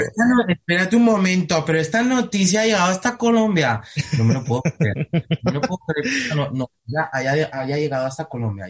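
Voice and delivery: put-on high-pitched, lilting Medellín paisa accent; Spanish actor putting on a paisa accent, in surprise; Colombian paisa accent. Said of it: "Yo hago el acento".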